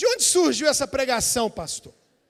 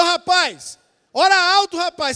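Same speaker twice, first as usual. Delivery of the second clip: put-on louder, higher, urging a shy boy; 5 semitones higher, 7 dB louder than usual. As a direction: push him to speak up. Father urging son to pray. (pai incentivando o filho a orar alto)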